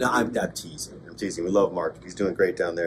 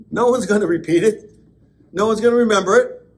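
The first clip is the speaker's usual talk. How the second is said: higher and louder, pressing the point; emphatic preaching; 11 semitones higher, 8 dB louder than usual. Real, not acted. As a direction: drive it home to the congregation.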